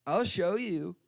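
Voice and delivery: lightly voiced drunk woman, eager to help; drunk lady. Drunk woman insisting she can take him there, lightly tipsy. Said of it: "like, really drunk".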